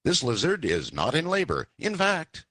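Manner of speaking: animated read-aloud of a funny family story. A narrator's reading. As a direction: read it out like a comic tale to listeners. Host reads funny story aloud.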